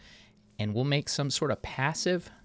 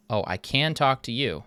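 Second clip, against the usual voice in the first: usual speaking voice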